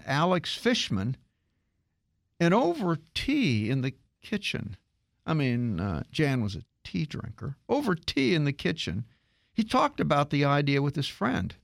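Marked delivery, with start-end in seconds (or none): none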